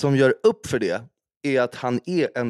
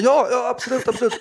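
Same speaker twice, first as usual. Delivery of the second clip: high-pitched, over-willing retold reply; mock eager yes, raised pitch; flustered eagerness; 10 semitones higher, 4 dB louder than usual. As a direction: imitate jumping to agree when called on. Flustered reply when suddenly called.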